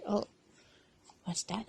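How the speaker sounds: ordinary delivery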